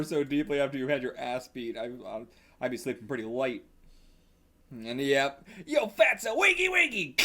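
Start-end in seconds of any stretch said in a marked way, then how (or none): 5.7-7.2 mocking wake-up call. Voicing a bully waking someone.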